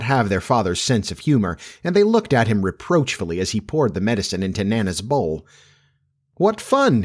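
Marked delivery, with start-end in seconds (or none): 6.4-7.0 high-pitched, unsure cheer; doubtful. A narrator's reading. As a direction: say it without quite believing it. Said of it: "he said doubtfully".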